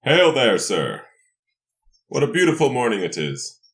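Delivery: ordinary delivery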